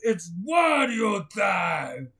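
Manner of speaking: loud, slow villain boast; Wario voice. Acted. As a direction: burst in as Wario. Wario character entrance.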